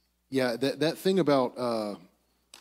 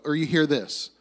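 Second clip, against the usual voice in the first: normal talking voice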